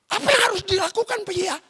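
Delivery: high-pitched question to daddy; eager child asking. Acted. Acted child asking father.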